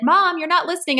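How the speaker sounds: high-pitched mock kid protest; imitated complaining child. Child's complaint voiced by mother.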